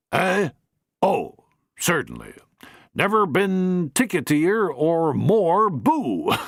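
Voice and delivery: a lightly voiced older man, slow and pleased; jovial old gent. Mister Banning Cannon answers Bingo, slow and jovial. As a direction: savour the joke slowly. The patriarch replies.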